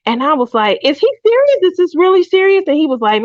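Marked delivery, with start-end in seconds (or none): 0.8-2.6 much higher, stunned questioning; you can't believe what you're hearing; high-pitched is-this-for-real asking; incredulous disbelief. Retold or imitated. Retold asking cousin in disbelief.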